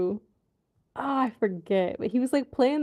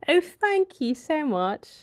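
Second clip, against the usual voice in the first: put-on British accent; 3 semitones higher, 3 dB louder than usual. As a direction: ham up a mock British accent. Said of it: "A little British accent".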